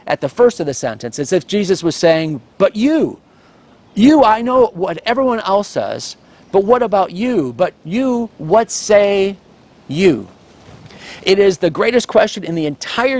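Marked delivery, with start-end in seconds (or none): 2.6-10.3 YOU hit high and hard each time; stress on YOU. Shown as a demonstration. Preacher pressing his flock on the word you. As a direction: lean on every you, pleading. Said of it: "has an, an enormous stress".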